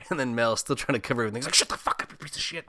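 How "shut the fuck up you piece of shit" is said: imitated irate telling-off; hammed-up irritation; mock angry outburst. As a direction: act out a cross "shut up". Acting pissed-off Mel cutting in.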